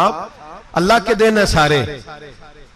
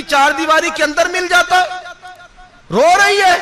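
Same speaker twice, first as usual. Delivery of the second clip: wrathful rebuke; 12 semitones higher than usual. Retold or imitated. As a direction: thunder at her for her ingratitude. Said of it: "हजरत उमर जलाल में आ गए".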